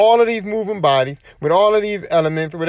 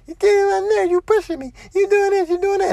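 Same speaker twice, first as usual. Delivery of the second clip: high-pitched mocking imitation of complainers; much higher, childish mock complaint; whining opponents. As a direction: mimic them in a squealing, put-on high voice. Mimicking frustrated receivers whining at him.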